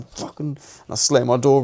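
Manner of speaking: ordinary talk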